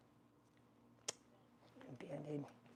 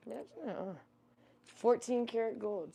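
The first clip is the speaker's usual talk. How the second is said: ordinary talk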